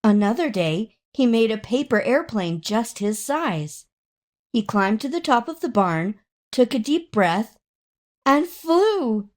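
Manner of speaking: ordinary delivery, nothing marked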